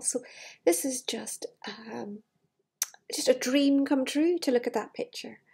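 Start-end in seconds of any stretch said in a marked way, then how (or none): none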